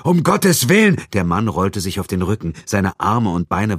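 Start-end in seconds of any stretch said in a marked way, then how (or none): 0.0-1.0 loud, high horrified outburst; shocked cry. A narrator's reading. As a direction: cry out in horror. Shocked at finding bound man. (entsetzter Aufschrei)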